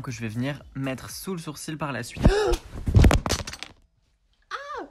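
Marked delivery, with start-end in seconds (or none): 2.3-3.6 loud startled gasp. Real, not acted. Speaker falls off chair. 4.5-4.8 startled cry. Real, not acted. Speaker falls to floor.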